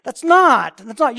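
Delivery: loud emphatic rebuke; loud emphatic denial. Real, not acted. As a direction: hit "not" hard and high, twice. Preacher rebukes the soft answer.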